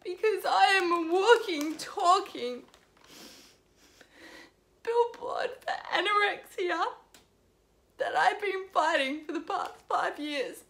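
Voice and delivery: high, tearful confession pushed out between sobs; real crying. Real, not acted. Vlogger admitting her anorexia to viewers, crying. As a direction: force the words out through tears. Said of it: "I'm just gonna cry".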